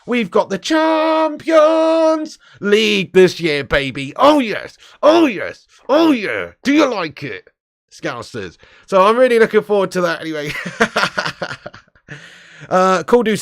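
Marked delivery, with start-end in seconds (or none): 0.1-4.1 high, loud, drawn-out boast; gloating crow. Real, not acted. United fan lording it over Scousers, gloating crow. 4.2-8.6 loud, high, swooping cheers; gloating taunt. Real, not acted. United fan teasing Scousers about the Champions League, gloating taunt. 10.6-11.8 high chuckle after a taunt; gloating chuckle. Real, not acted. Host amused after teasing Scousers, gloating chuckle.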